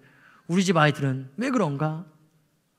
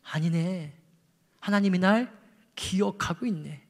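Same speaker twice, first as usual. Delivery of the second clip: dropped much softer, slow at the end; hushed realisation; 8 dB quieter than usual. Acted. Elijah realising God remembers him, hushed. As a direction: let it fall to a near hush. Quiet turn after climax.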